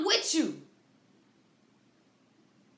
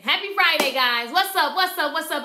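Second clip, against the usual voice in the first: excited greeting; 8 dB louder than usual. Real, not acted. Host greeting viewers joining the live stream, loud and excited. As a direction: hype up the stream.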